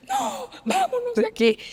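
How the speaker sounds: high, slow, tear-choked plea; retold crying, high and slow; tearful. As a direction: let the voice crack up high as if crying. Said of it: "Salí llorando".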